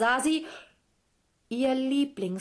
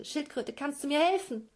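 pleading Kelolan; 7 semitones higher than usual. Acted. Kelolan begs the turtle.

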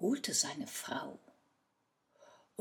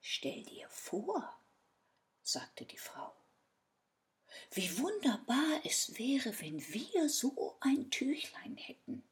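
whispered scheming; 8 semitones higher than usual. Acted. Wife whispers her scheme.

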